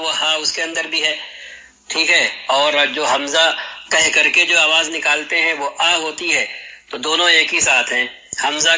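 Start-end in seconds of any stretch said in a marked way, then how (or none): none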